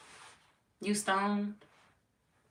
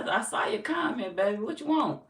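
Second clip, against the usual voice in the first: usual speaking voice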